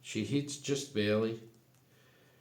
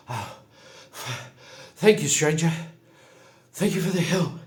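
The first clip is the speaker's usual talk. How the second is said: high, loud, gasping thanks in character; worn-out Grinwit; 6 semitones higher, 6 dB louder, faster than usual. Acted. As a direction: play a winded, rattled survivor gushing thanks. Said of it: "like, tuck it out, he's tired".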